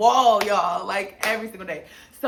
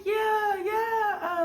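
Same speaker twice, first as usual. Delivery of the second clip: excited yeah; 13 semitones higher than usual. Retold or imitated. Cam confirming to Regina, high excited yeah. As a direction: answer giddily, near tears. Cam answering through vent.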